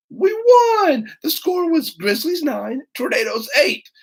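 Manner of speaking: high, thrilled announcement; excited. A narrator's reading. Jimmy announcing the victory, high and thrilled. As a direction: crow about the score.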